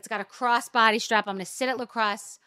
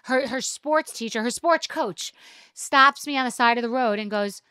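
ordinary talk

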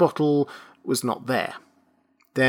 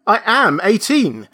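higher and louder insistence; insisting; 8 semitones higher, 6 dB louder than usual. A narrator's reading. Benjamin insists again to the registrar, stubborn.

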